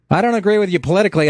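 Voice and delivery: caller quote. Retold or imitated. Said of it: "the people calling in".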